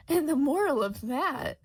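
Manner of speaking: high, loud announcement; loudly. A narrator's reading. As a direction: proclaim it across the garden. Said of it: "the Duchess adds loudly".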